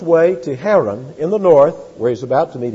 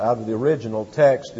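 ordinary talk

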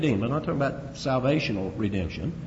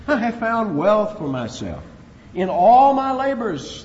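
smug boast; 5 semitones higher, 7 dB louder, slower than usual. Retold or imitated. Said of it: "Ephraim said".

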